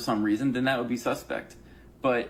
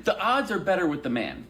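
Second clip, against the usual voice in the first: normal talking voice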